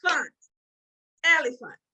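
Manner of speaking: EL-E-PHANT, loud, in time with claps; clapped syllables. Shown as a demonstration. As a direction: Elephant as three punched clapped beats. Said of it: "let's clap it out".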